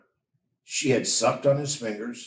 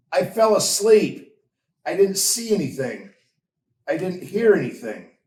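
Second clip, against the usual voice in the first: higher, louder, a confused protest; baffled and a bit defensive; bewildered; 6 semitones higher, 5 dB louder than usual. A narrator's reading. Benjamin tells David he saw nothing, bewildered. Bewildered boy answers brother.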